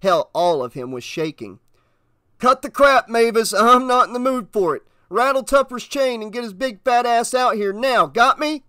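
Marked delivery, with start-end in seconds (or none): 2.4-8.6 loud, high, fed-up outburst; angry order. Acted. Shaken officer ordering the dispatcher to get the sheriff, angry. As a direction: lay down the law, loud and high.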